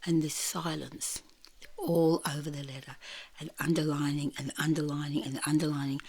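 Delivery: soft, low narration; low, quiet, suspenseful telling; hushed storytelling. Real, not acted. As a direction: drop to a hush for the silent room. Storyteller evokes the silent class.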